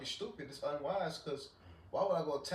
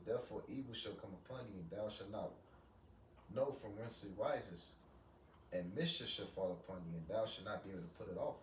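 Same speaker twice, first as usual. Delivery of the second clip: scripture reading; 6 dB quieter than usual. Acted. Reads Isaiah aloud again.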